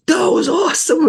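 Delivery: acted-out burst of delight; retold excitement. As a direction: squeal it like an elated young man. Thrilled young man after helping woman.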